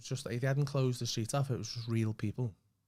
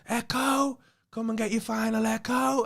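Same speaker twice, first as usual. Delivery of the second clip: high, loud market cry stretched out; Echo seller's shout; 11 semitones higher, 6 dB louder, slower than usual. Shown as a demonstration. Selling papers on Matthew Street, loud high street cry. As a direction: call it down the street to sell papers. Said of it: "They all shout Echo repeatedly".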